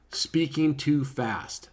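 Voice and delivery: drawn-out slow phrase; slow, deliberate. Shown as a demonstration. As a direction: say it very slowly. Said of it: "if anybody's seen me speak".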